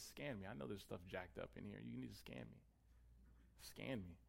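soft, confiding prayer in paraphrase; hushed plea. Acted. Preacher voicing David asking God to search him, hushed plea. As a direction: drop the volume and plead quietly.

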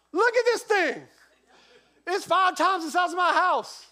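high, loud amazed gush; awed exclamation. Retold or imitated. Pastor marvelling at the huge school tree, loud and high. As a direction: marvel at it like a kid.

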